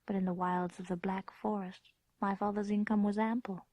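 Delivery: reflective storyteller narration; story narration. A narrator's reading. Grown woman recalling her childhood castle, hushed narration. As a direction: tell it as a memory tinged with dread.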